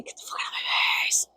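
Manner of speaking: whispered threat, barely voiced; menacing whisper. Warning off a nosy stranger, whispered menace. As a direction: hiss it under your breath, menacing.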